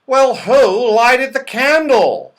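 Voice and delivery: a fretful round-faced fellow asking who did it; anxious Moonface. A narrator's reading. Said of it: "his big round face looking anxious".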